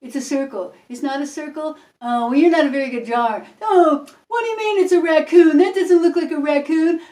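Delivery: mocking, sneering guesser. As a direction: belittle the drawer. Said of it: "make fun of people".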